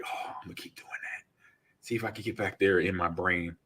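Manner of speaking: hushed whisper to himself; whispered inner thought. Retold or imitated. Drifting back toward a football dream, sleepy whisper. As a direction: murmur it under the breath, half asleep.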